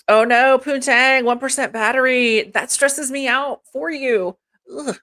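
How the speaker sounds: usual speaking voice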